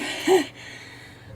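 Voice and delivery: short unvoiced breathy laugh; breathless laugh. Real, not acted. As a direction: laugh at yourself, winded. Laughs at being winded.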